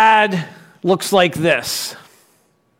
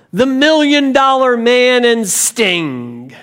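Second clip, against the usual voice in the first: hyped promo voiceover, pitched up; 4 semitones higher than usual. Retold or imitated. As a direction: read it like a wrestling event promo. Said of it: "the video has the voiceover on it".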